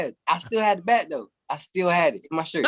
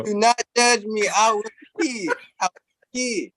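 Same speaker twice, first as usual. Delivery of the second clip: pleading higher replay; higher, slower repeat; 5 semitones higher than usual. Shown as a demonstration. Friend asks him to repeat, he pleads higher and slower. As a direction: say it again, higher and slow. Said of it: "Say that part again for me".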